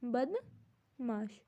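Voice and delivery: "बदमाश" drawn out slowly, split as बद, माश; broken into syllables. Shown as a demonstration. Teacher gives the meaning to learners, "बदमाश" slowly syllable by syllable. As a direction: say "बदमाश" in two slow, separated parts.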